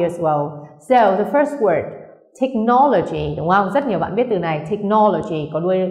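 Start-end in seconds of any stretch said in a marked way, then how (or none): 2.4-3.3 technology with NO, the second syllable, stressed. Shown as a demonstration. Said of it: "nên nhấn vào no". 4.7-5.5 technology stressed on its second syllable, NO. Shown as a demonstration. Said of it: "nên nhấn vào no".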